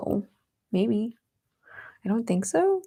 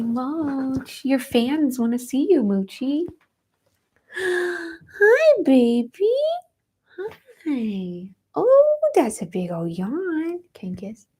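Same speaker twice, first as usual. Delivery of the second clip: higher, sing-song cooing at the cat; 5 semitones higher, slower than usual. Real, not acted.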